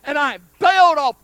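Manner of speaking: loud, high burst of storytelling; excited shouting. Retold or imitated. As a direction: relive the leap at full voice. Relives leaping off to chase him.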